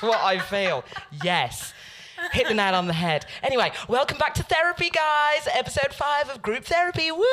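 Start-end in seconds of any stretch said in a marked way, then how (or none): 3.4-7.2 high, loud, fast hype intro; host welcome. Acted. Host hyping episode five to the listeners, high and loud. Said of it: "host re-opening the show".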